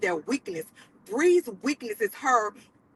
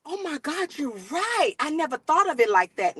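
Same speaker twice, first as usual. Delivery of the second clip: breathless, wide-eyed realization; gasping amazement; 5 semitones higher than usual. Retold or imitated. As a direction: gasp it out like an epiphany.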